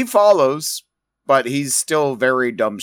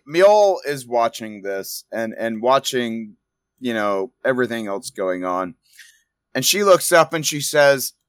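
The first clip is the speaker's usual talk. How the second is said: ordinary talk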